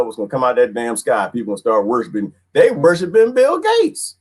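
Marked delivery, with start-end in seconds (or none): 2.5-4.2 high, slow incredulous holler; drawn-out high-pitched disbelief; mock outcry. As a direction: cry it out way up high. Mock outcry about worship.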